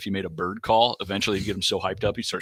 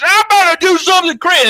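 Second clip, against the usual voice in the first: much higher, louder, wild announcement; crazed player; 9 dB louder than usual. Retold or imitated. Hyped-up player announcing craziness in a wild high voice. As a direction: wind up like you're about to lose it. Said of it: "right before he got crazy, he goes".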